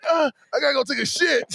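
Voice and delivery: high, retold little-kid voice; high-voiced little Jason-style child; little kindergartner. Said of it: "little Jason in kindergarten".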